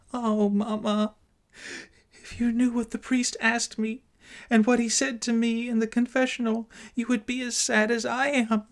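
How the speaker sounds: put-on a frightened child fighting back sobs; trembling, sob-choked. A narrator's reading. Daughter pleading with her mother, high tearful girl's voice. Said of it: "In a trembling voice and half suppressed with sobs".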